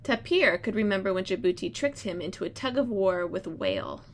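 storybook read-aloud voice; reading to children. Acted. Teacher reads story aloud.

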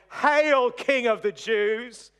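loud, high mocking proclamation, drawn out; jeering crowd's loud salute; mocking hail. A narrator's reading. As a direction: hail him mockingly, ringing out slowly. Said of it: "they mocked him".

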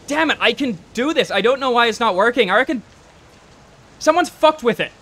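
loud, high-pitched angry outburst; frustrated Harry. Acted. Would-be driver failing to start the bus, frustrated shouting. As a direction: raise pitch and volume, furious that it won't work. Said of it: "Harry goes".

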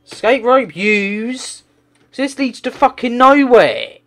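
real boiling-over gamer rage; furious rant. Real, not acted. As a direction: shout every complaint at the screen. Raging at the game.

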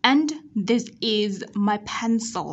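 slow "And this is my pencil" with clear final l; teacher reads "And this is my pencil" carefully; measured "And this is my pencil", tongue curled on last l; model reading. Shown as a demonstration. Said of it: "Chúng ta sẽ uốn nhẹ lưỡi ở cuối từ".

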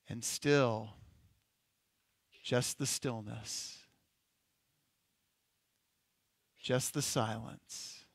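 hushed, contemplative guided imagery; guided meditation. Acted. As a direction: let each phrase settle into stillness. Guided imagination exercise continues.

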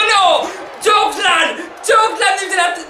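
high, sudden yell of shock; excited shout. Real, not acted. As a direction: shout it out in disbelief. Finisher hits the gamer's wrestler.

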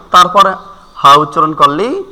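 ordinary talk

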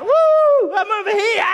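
high, loud playful hoot and call; hiding child calling to the seeker. Retold or imitated. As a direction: cry out so the seeker finds you. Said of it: "you gotta cry out".

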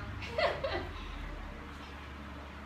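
soft breathy laugh. Real, not acted. Laughs at partner's teasing remark.

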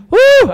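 loud, high hooted whistle; imitated whistle. Husband blowing the whistle for the hawk, loud imitation. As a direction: whoop like a whistle blast. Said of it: "I blow the whistle".

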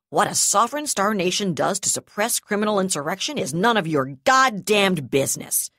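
loud and harsh. A narrator's reading. Brigadier harshly rebuffs the commodore. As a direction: bark it down the comm. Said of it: "she said harshly".

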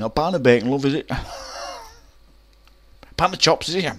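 high, laughing jolly butcher; high, loud, laughing old butcher at the counter. Retold or imitated. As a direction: chuckle through it like a beaming shopkeeper.

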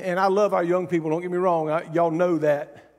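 ordinary talk

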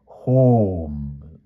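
lightly voiced correcting knight; a hint of a fussy old scholar; knightly correction. A narrator's reading. Palomides correcting Pellinore's grammar, fussy. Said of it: "said Sir Palomides".

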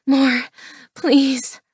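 urgent, pleading female lover; gasped plea. Acted. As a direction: gasp it out, begging. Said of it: "she gasped".

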